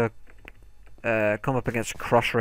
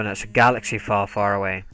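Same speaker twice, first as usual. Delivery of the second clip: normal talking voice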